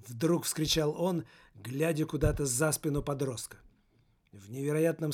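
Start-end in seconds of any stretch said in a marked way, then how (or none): none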